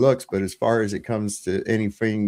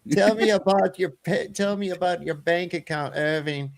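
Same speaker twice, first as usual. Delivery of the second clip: higher mock bride voice, coaxing; 5 semitones higher than usual. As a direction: wheedle Irving about his money.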